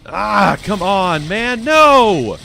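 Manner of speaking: high, loud frustrated groaning; protest, building to a loud cry; real frustration; exasperated protest. Real, not acted. Losing the fight.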